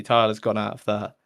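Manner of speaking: ordinary delivery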